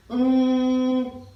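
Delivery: INCA with a long humming NG, then CA; very slow, drawn out. Shown as a demonstration. Teacher practicing tongue placement, INCA said extremely slowly. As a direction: hold the first sound of INCA very long before releasing CA. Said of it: "I say it very slowly".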